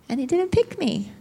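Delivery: high mock-hurt whine on "pick me". Preacher retelling being passed over, mock whine. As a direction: whine it like someone left out.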